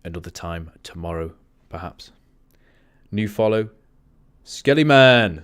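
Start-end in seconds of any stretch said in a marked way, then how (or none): 3.1-5.4 loud, high excited greeting; excited shout-out. Real, not acted. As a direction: call out the name with excitement. Greeting a new follower.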